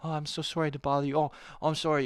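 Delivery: lightly voiced timid apology, a bit high; mock meek sorry-to-bother-you voice; polite apologetic Canadian. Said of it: "as a polite".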